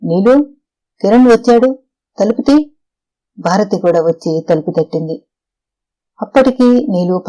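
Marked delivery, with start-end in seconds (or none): 0.0-2.6 higher, raised call with rising ends. A narrator's reading. Calling to Neelu behind the shut door, urgent raised call. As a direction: call through a door, urging a reply.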